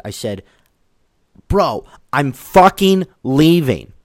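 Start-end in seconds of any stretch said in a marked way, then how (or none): 1.5-3.9 loud, high, drawn-out snap; re-enacted angry yell. Climber who snapped at the man policing his mask, retold yell. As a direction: snap back at full voice, slowly hitting each word. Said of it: "I yelled that".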